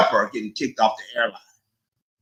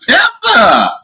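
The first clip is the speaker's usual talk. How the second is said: put-on Pepper shouted high in a New York accent; loud, high New York accent imitation; 10 semitones higher, 9 dB louder, much slower than usual. Streamer mimicking a guest's accent to viewers, loud New York accent. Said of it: "gotta love that New York accent".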